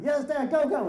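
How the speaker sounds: root for Dad like a fan; cheering kids. Retold or imitated. Kids cheering their dad's lemon peeler, excited and high.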